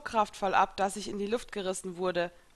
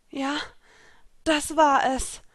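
gasping agreement, higher and louder; breathless agreement after the rescue; out of breath; 7 semitones higher, 6 dB louder, slower than usual. Acted. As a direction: push it out after catching breath. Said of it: "nach Atemringen".

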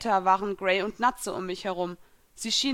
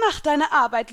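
loud, high order to a servant; raised-voice command; sharp command; 6 semitones higher, 7 dB louder than usual. A narrator's reading. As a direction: order the attack. Mistress orders her spirit to attack.

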